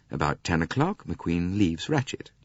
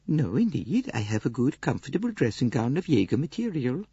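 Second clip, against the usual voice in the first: much higher, gentle Swedish lady; Greta Olson voice; 7 semitones higher than usual. Acted. Swedish lady answering Poirot's questions, high gentle voice. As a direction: lift into a mild, earnest Swedish companion.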